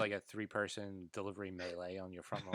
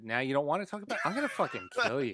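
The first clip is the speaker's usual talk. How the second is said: laughing; 6 semitones higher, 5 dB louder, faster than usual. Real, not acted. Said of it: "mock threat while laughing".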